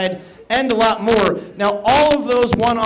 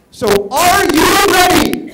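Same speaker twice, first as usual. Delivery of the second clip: crowd call-out; 8 semitones higher, 8 dB louder than usual. Real, not acted. MC calls to the crowd.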